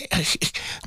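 a short breathy chuckle; soft laugh. Real, not acted. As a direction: laugh quietly under the breath. Laughs mid imagined listener quote.

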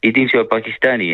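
normal talking voice

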